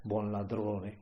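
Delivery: usual speaking voice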